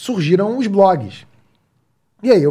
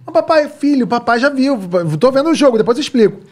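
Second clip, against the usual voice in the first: impatient dad; 8 semitones higher than usual. Retold or imitated. Dad losing patience with son during match. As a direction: cut him off, eyes on the game. Child then father, retold.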